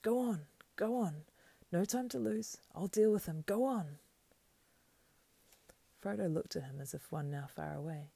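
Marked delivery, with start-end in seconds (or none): 0.0-4.0 high, loud urgent calls; gasped urging. A narrator's reading. Servant sending his master on while he fights, urgent gasps. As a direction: urge him onward in raised gasps. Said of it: "he gasped".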